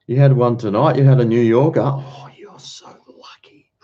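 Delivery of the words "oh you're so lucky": a sudden breathy whisper, high and soft. Real, not acted. Teasing Belinda about her pizza in a whisper. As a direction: lean in and whisper it like a tease.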